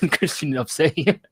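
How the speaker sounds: retold question broken up by laughter; laughing retelling. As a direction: laugh your way through the quote.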